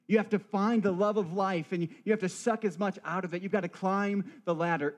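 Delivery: rally them to seize life, piling it on; urgent live-it-up pitch. Acted. Voicing the tempting live-it-up narrative.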